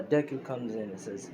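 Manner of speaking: ordinary delivery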